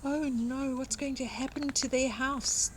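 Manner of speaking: usual speaking voice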